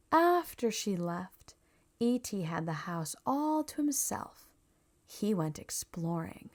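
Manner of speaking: ordinary delivery, nothing marked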